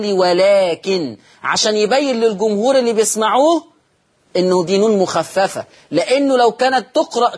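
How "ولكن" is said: ولكن said very slowly, نون light with no nasal hold; light nun, slow pause. Shown as a demonstration. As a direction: stop on ولكن dragging it, keep the نون light. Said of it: "يقف على هذه اللفظة ويقول". (وقف على ولكن بنون مخففة ببطء)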